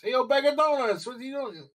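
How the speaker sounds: high-pitched street call-out; street hail. Acted. Hailing a Joey on a New York street, high mock call-out. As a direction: holler it high, then ease off.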